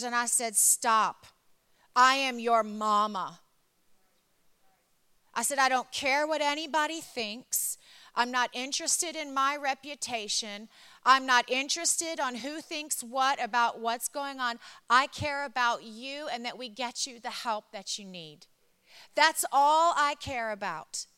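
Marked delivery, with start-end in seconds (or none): 0.5-3.4 loud, emphatic motherly command; firm retold interruption. Mother stopping her apologizing daughter, retold loud and firm. 5.6-21.1 raised, forceful motherly declaration; emphatic retold insistence. Mother reassuring her ashamed daughter, retold with loud insistence.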